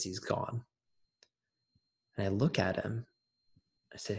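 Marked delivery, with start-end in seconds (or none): 0.0-0.6 soft, understated delivery of bad news; quietly retold heartbreak; hushed grave news. Retelling brother breaking news of death.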